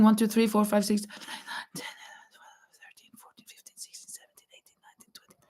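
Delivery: a playful count that trails off into a whisper; counting fading to a whisper. Pretend. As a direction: count on, dropping from soft voice down to a bare whisper. Counting a viewer's laughing rounds.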